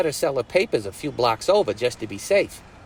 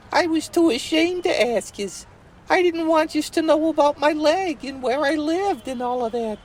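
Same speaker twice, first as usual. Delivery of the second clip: put-on much higher, bashful girl confessing; ashamed Betsy voice; 12 semitones higher than usual. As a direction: confess in a high shy voice.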